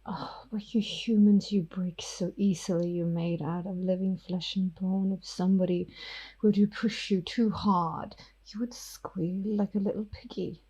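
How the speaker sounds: hushed, menacing vampire whisper; whispering vampire Ophelia. Acted. Ophelia the vampire threatens Miss York, whispering. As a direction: breathe the threat softly at her.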